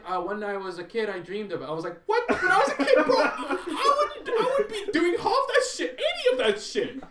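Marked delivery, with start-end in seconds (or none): 0.1-1.6 raised, louder quoting of his excuse; mock justification. Host mimics the stuntman's justification, mock-earnest. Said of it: "justification is like". 2.1-7.0 very high, loud, laughing mock outrage; incredulous outburst. Host answering the stuntman's childhood dream, incredulous high yelling.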